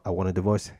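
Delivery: low, matter-of-fact interruption; deadpan announcement. Acted. Husband cuts off wife's excuse, deadpan. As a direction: cut in low and unbothered. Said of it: "I'm gonna say".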